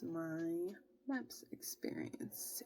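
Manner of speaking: slow whisper. Real, not acted. Vlogger closing her MEPS story, slow whisper. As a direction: whisper it slowly like a final word.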